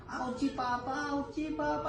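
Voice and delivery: mock whimper of a hurting kid; pretend hurting child. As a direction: play the child saying it hurts, softly. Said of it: "Kunyari, sobrang masakit".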